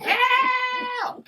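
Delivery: high, drawn-out mock scream; imitating a full-volume yell; screaming for help. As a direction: shriek it like a hammed-up cry for rescue. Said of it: "screaming at the top of his lungs".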